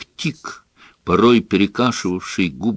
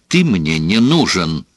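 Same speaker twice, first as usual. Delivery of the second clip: ordinary delivery, nothing marked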